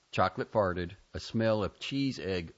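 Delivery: ordinary delivery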